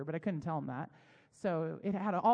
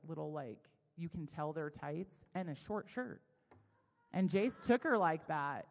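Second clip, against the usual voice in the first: ordinary delivery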